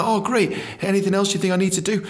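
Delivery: high, fast eager reply; keen to please; high quick chirpy question. Retold or imitated. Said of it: "I was like".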